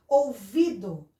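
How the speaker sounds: "Ouvido" slowly, stretching VI; "ou-VI-do", drawn out; strong syllable shown. Shown as a demonstration. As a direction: sound out "Ouvido" with weight on VI. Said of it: "é o V".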